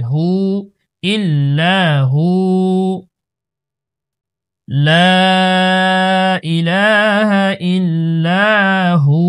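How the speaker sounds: slow chanted recitation, হু held long; one-alif stretch. Shown as a demonstration. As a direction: draw out every long vowel, resting on হু. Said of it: "এক আলিফ টান হবে". (হু টেনে ধীরে তিলাওয়াত)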